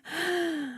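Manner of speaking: quick high breathy gasp into the chest; shallow up gasp. Shown as a demonstration. Coach demoing the shallow upward breath for listeners, gasp. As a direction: show the wrong, lifted breath. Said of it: "more of an up thing".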